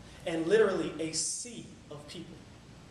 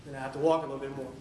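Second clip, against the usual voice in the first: dropped pitch, hurried words; subdued walking take; 4 semitones lower, much faster than usual. Retold or imitated. Runner admits having to walk, lower and fast. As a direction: drop low and rattle it off. Acts out running versus walking.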